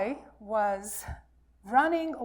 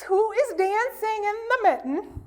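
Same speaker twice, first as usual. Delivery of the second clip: higher put-on bear voice; bear asking; 9 semitones higher, 4 dB louder than usual. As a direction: ask it in a hammed-up character voice. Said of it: "and asks".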